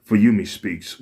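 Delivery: plain reading voice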